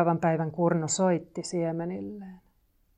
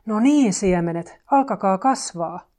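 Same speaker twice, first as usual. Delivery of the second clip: raised, firm call to the seeds; loud call; 8 dB louder than usual. A narrator's reading. Kurnu calls loudly to the seeds, head near the ground. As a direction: call out loudly, stretching the words a little. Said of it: "huusi kovalla äänellä".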